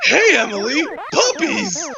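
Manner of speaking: high, bouncy call of puppies; excited. Acted. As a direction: squeal it like a kid who just saw puppies. Excited Jerry greeting.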